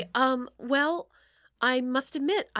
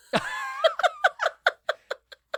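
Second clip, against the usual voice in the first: high laugh. Real, not acted. Laughs after singing.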